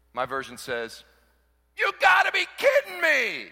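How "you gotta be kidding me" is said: high, loud mock disbelief; incredulous shout. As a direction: shout it like you can't believe it. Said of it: "Paul responds in verse thirteen with".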